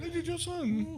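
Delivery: high, soft mock English accent; English accent, playfully high and soft. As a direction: say it like a prim Englishman. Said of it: "Try it if you were from England".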